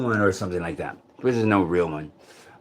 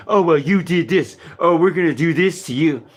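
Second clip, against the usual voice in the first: higher, louder sneering voice taunting; mocking taunt; 6 semitones higher, 3 dB louder than usual. Imitating the voices that pick fights, sneering. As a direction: mimic the bullying voices with a sneer. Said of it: "they always pick fights with me and say".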